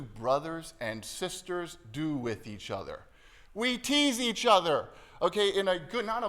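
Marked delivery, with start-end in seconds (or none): 3.5-6.3 excited emphasis. Real, not acted. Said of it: "lively preaching".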